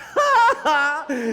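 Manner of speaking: high, loud ha-ha-ha; scornful rabbit laugh. Acted. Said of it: "the rabbit just laughed".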